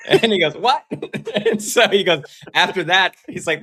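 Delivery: high, loud telling through laughter; laughing. Real, not acted. Co-host tells his pastor's mirror story, laughing. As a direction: crack up while telling the story.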